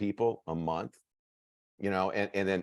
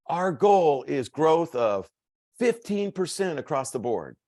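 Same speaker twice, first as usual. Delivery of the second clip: mock corporate goal announcement; 7 semitones higher, slower than usual. Boss announcing the yearly goal.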